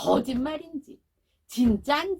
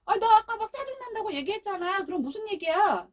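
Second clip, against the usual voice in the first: pressing mother; 6 semitones higher than usual. Retold or imitated. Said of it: "엄마가 또 막 다그쳐요".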